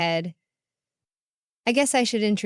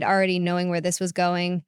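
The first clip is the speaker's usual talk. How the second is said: ordinary delivery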